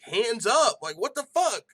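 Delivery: loud, high burst of disbelief; incredulous reenacted shout; exasperated yell. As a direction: shout it like yelling at a fighter on screen. Re-voices his yelling at the fighter.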